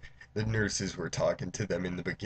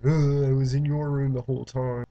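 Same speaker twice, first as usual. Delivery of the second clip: higher, louder imitation of a kid; excited little daughter; 5 semitones higher, 6 dB louder than usual. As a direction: lightly play a child calling out. Little daughter runs in, acted.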